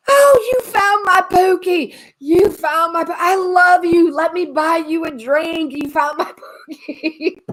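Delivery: overjoyed Southern lady thanking the dog's finder; Southern accent. Retold or imitated. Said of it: "as Southern as you get".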